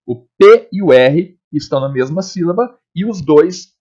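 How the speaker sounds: ordinary talk, nothing marked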